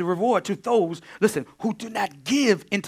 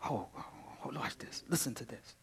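whisper; 2 semitones lower, 17 dB quieter than usual. Real, not acted. Preacher leans in to congregation.

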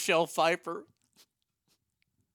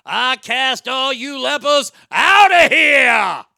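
slow, much louder and higher bellowing Pacino; Pacino shout; 7 semitones higher, 13 dB louder, much slower than usual. Acted. Pacino's Jesus yelling at the lepers, hammed-up shout. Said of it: "Pacino as Jesus would be fantastic".

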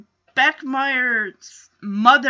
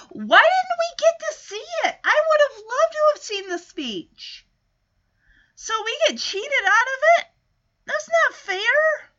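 much higher, indignant whine; indignant complaint; 12 semitones higher than usual. Real, not acted. Host complains about being cheated out of seeing the speech, indignant. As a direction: protest in a high, aggrieved voice.

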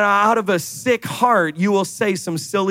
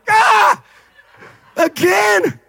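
high, loud cry of mock dismay; exasperated outburst; 12 semitones higher, 5 dB louder, much slower than usual. Envious scroller seeing another blessed post, exasperated high yelp. Acted envy at others' Instagram posts.

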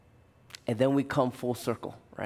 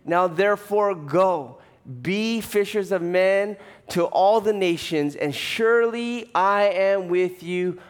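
high, loud preaching climax; full-force preaching; 8 semitones higher, 5 dB louder than usual. Acted. Preacher rallying the congregation to go make disciples, loud and high. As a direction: pastor preaching the great commission to church, at full force.

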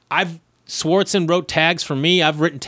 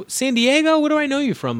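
high, puzzled imitation of a woman; Courtney Cronin; 12 semitones higher than usual. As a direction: lift the pitch right up, trying to place someone. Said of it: "And she goes".

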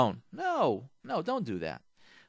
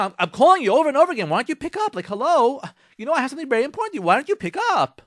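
high, loud, rushed nagging complaint; exasperated spouse; 9 semitones higher, 7 dB louder, faster than usual. Acted. Nagging spouse about unanswered calls, exasperated. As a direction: demand an answer, pitched way up.

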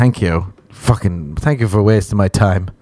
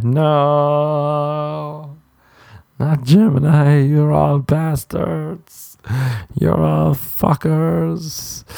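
mock disgusted date; 6 semitones higher, much slower than usual. Mimicking a girl hearing his sign.

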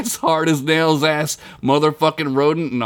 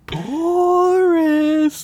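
high, drawn-out spectral call; gentle ghostly whisper. Acted. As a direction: call his name from far away like a ghost. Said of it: "a gentle whisper blew through the wind".

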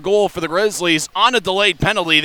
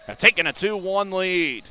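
usual speaking voice

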